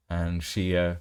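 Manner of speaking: ordinary talk, nothing marked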